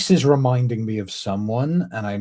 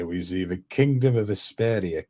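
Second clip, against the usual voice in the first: usual speaking voice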